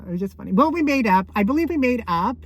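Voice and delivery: ordinary talk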